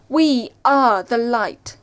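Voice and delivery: loud, slow proclamation; defiant shout. A narrator's reading. Narrator and Treth face down the fangs, shouting slowly. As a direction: shout it out, stretching each word. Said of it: "We shouted".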